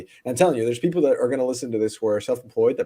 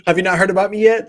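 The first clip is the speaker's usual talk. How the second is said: louder repeat with a laugh in it; repeated louder after a mishearing; 8 semitones higher, 9 dB louder than usual. Real, not acted. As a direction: say it again louder so the guest hears. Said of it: "Say again?".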